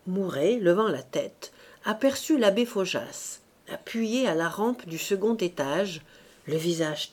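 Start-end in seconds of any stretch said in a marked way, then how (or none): none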